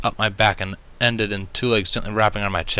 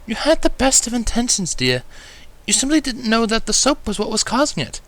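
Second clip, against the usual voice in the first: warm reassurance; 13 semitones higher than usual. A narrator's reading. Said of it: "Rarity assured warmly".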